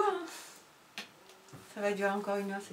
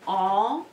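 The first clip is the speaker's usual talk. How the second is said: en said louder, set apart; sound en stressed; 4 dB louder than usual. Shown as a demonstration. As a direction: isolate the sound en clearly. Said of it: "Le son".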